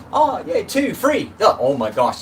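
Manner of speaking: high, loud excited whoops, easing down by the end; cheered-up delight. Real, not acted. Streamer thanking viewers as thumbs up come in, delighted and excited. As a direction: exclaim with a burst of delighted energy. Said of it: "just cheered me up".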